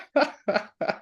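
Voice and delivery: high-pitched burst of laughter. Real, not acted. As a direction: break out laughing. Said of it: "I'm laughing".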